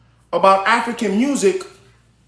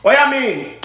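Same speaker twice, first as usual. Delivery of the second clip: put-on Jamaican accent; 6 semitones higher than usual. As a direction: toss it off in a Jamaican lilt. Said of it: "shout out to my Jamaicans".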